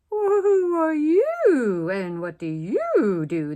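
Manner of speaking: slow, high, lofty giraffe voice; not very nicely. Acted. Giraffes questioning the cow, high, slow and snooty. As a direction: look down your long neck and drawl it. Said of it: "but not very nicely".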